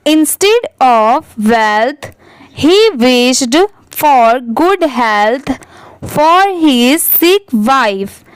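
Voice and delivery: very slow, pausing between phrases; slow deliberate read-aloud. Shown as a demonstration. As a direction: read each phrase slowly to the class. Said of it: "एक बार clear करके देखते हैं".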